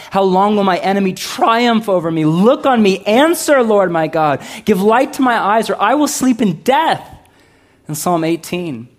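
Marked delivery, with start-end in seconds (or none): 0.1-7.0 rising, louder pleading that climbs high; building cry to God. Acted. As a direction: cry out to God, pushing higher each line. Psalm cry building to plea.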